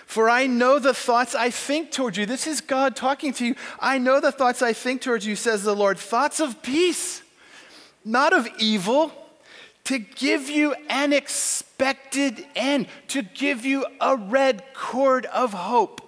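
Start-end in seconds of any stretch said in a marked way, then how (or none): none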